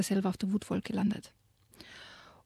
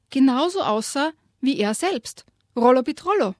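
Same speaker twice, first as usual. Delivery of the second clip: dramatic reveal; 5 semitones higher, 5 dB louder than usual. A narrator's reading. Climax of the realisation.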